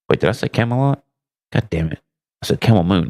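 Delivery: ordinary talk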